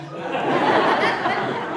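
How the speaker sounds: high, loud laughing burst; short loud laugh. Real, not acted. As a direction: let a real laugh out. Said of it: "But now it gets funny".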